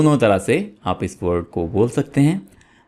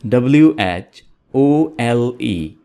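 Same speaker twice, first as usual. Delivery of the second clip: spelling it out. Shown as a demonstration. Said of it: "repeat कर देता हूँ".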